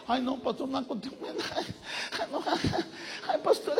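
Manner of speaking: mock terrified sister, barely voiced; fearful whimper. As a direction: whimper it like she's too scared to move.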